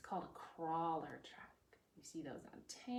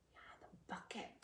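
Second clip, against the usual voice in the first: whisper; 11 dB quieter than usual. Real, not acted. Whispered answer.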